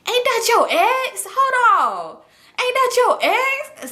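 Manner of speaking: high, stretched-out mock astonishment; imitated incredulous call-out; homeboy calling out. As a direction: draw it out high, like stopping someone. Homeboy calls out in retold scene.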